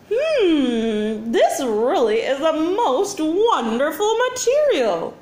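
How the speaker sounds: slow, high cooing alien voice; cooing alien commander. A narrator's reading. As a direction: coo over it with delight. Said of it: "cooed the commander".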